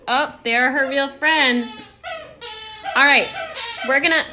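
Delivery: real laughter breaking into the words; delighted laughing over the noise. Real, not acted. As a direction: laugh through it, loud and delighted. Kids' toy noise, real friends.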